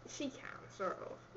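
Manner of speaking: whisper. Real, not acted. Girl whispers a teasing aside about her friend.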